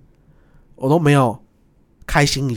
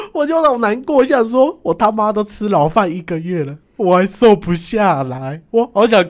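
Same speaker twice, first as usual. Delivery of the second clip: comic self-pity; 6 semitones higher, slower than usual. Retold or imitated. Comic self-pity over not losing weight.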